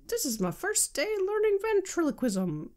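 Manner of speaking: high imitation of a beginner ventriloquist; put-on high ventriloquist voice; novice ventriloquist. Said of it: "little TikTok that's been going around".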